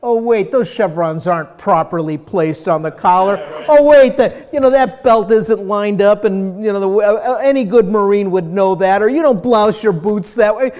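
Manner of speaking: loud, high-pitched, fussy objections; nitpicking critic. Acted. Ex-Marine picking apart a military movie, nitpicking critic. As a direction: pick it apart out loud, worked up. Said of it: "I'm a critic. It's like".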